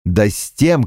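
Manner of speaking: ordinary delivery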